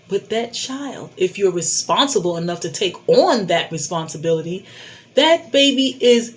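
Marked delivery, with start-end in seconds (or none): none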